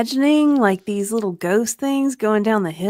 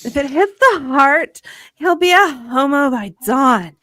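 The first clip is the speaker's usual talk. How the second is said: loud, raised-pitch melodrama; dramatic warning; 5 semitones higher, 11 dB louder than usual. Acted. Yamaha warning about the wound, melodramatic loud delivery. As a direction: deliver a hammed-up dire prognosis.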